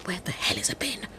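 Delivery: alarmed whispered aside. Acted. Hunted woman whispers in alarm to herself. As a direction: hiss it to yourself, no voice.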